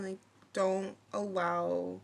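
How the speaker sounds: usual speaking voice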